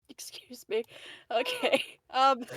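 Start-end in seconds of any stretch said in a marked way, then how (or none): 0.1-0.8 whisper. Real, not acted. Apologizing after laughing fit.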